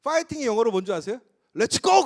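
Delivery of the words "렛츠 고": high, loud rallying shout; excited call to charge; English cheer. Shown as a demonstration. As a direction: burst out with a punchy cheer.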